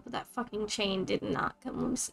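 bitter quiet. Acted. Bitter aside in voicemail.

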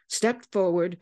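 ordinary delivery, nothing marked

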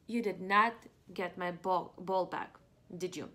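ordinary delivery, nothing marked

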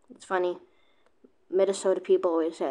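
ordinary delivery

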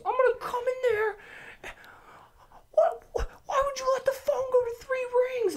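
imitated raging scream; high, strained mock screaming threat; Trip. Boss raging about unanswered phone, imitated screaming. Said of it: "He starts screaming at me".

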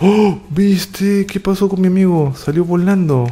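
high-pitched gasp of surprise, then lively wonder; amazed reaction. Real, not acted. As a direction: exclaim high at a spectacular hit. Teammate sent flying in game.

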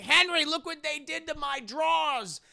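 high, loud mock shriek; outraged woman. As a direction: holler in hammed-up outrage. Outraged neighbour shows defaced underwear.

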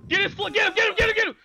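frantic high shout; yelling from the sidelines. Real, not acted. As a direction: yell it at the team. Yelling at team mid-fight.

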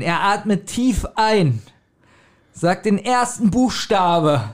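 slow tense storyteller holding the listeners on edge; suspenseful narration. Shown as a demonstration. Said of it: "ich probiere jetzt Spannung aufzubauen". (erzählt seinen Freunden die Szene langsam und spannungsvoll)

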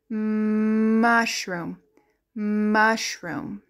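"Mushroom" with a long hummed mmm at the start; stretched first sound. Shown as a demonstration. Teacher showing what M says, "Mushroom" begun on a long mmm. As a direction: hold the M of "Mushroom" before going on.

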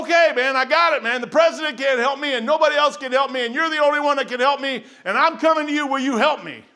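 loud, high pleading prayer; desperate plea. Retold or imitated. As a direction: beg God for help, loud and high. Retold desperate prayer to God.

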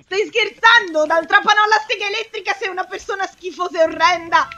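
high, loud scolding; indignant, high-pitched tirade; outraged panic. Real, not acted. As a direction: yell at the attacker in disbelief. Outraged at chainsaw attacker.

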